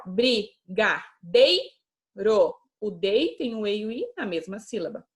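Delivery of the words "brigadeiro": slow, syllable by syllable, rising at the end; teacher separating syllables for pupils; slow spelling out. Shown as a demonstration. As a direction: split the word into its syllables. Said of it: "a gente separa".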